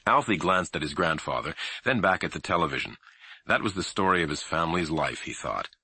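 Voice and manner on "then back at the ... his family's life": ordinary delivery, nothing marked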